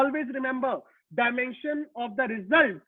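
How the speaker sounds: heading stretched out very slowly; slow dictation. Shown as a demonstration. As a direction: dictate it for the notebook. Teacher dictating a rule.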